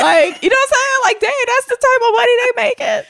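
high, loud incredulous squeal; amazed outburst. Retold or imitated. Co-host reacts to a huge salary, amazed. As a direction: blurt it out high and loud, astonished.

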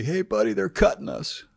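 high-pitched mock complaint; imitated yelling. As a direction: call out a grievance to the coach, half-yelled. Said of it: "would be yelling to Buddy".

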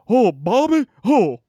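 loud, high exasperated Texan groan; a hammed-up Hank Hill sigh over his son. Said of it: "the only thing I know".

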